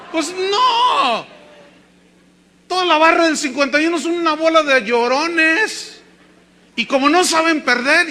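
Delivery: playful loud sermon jab; mocking shout. Real, not acted. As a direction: roast the Atlas supporters with a shout. Preacher mocks rival football fans.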